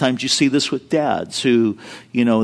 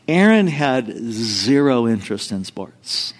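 normal talking voice

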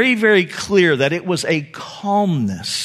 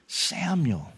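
much softer and lower, a gentle calling of the name; tender call; 6 semitones lower, 11 dB quieter than usual. Acted. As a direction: call "Samuel" softly, like waking a child. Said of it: "It's tender".

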